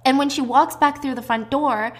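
plain reading voice

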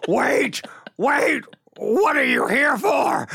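high, stretched shout calling after someone; panicked high yell. Retold or imitated. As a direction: cry out wait, wait in mock panic. Retold panicked call after him.

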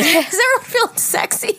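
laughing, pitched high. Real, not acted. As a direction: giggle through the question. Laughing repeat.

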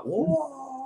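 high, drawn-out whoa of wonder; amazed whoa. Retold or imitated. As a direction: gasp at a huge whale. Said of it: "you're like".

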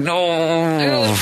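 high, long drawn-out protest; mock dismayed no. Podcaster recalling his reaction to the names, mock dismay. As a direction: stretch it into a pleading wail, playfully.

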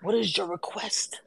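hushed, slow mob boss impression; The Godfather. Host playing a gangster boss granting favours, slow whisper. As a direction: whisper it slowly like a crime boss. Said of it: "like The Godfather".

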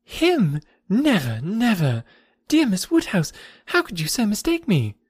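high-pitched, upset denial; wounded high-pitched outcry; distressed protest. A narrator's reading. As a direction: deny it in dismay, voice lifted. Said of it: "turning away distressed".

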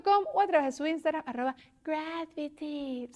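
upbeat sponsor plug read to listeners. Acted. Host reads the sponsor ad to listeners, upbeat ad read. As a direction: sell the studio like a radio spot.

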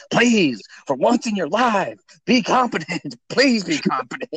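imitating irate customer. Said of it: "he gets so irate, like he's having trouble breathing".

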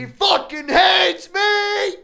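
high, slow shouted lyric. Pretend. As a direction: scream the line out.

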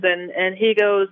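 ordinary talk, nothing marked

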